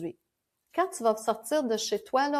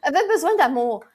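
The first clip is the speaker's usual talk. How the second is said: louder, quick echo with a laugh; amused; 3 semitones higher, 6 dB louder, faster than usual. Real, not acted. Echoing the co-host's joke, amused and laughing. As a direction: laugh it out fast and loud.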